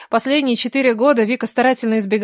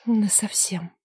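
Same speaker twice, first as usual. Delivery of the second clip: a much softer falling answer; quiet confirmation; 8 dB quieter, slower than usual. Acted. Vika confirms the divorce, soft and falling. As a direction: confirm it flatly, barely aloud.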